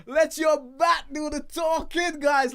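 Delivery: emphatic call. Acted. Host urging West Indies cricketers, loud rallying call. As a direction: shout the motto to the team.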